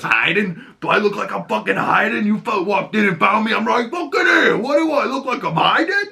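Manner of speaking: high, mounting mock outrage; indignant yell. Acting out Frank insulted at being accused of hiding, indignant yell. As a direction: build into an incredulous, hammed-up shout.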